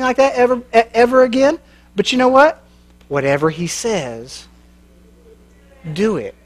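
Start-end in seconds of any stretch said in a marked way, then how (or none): 3.1-6.3 soft slow refrain. Acted. Sermon refrain softened.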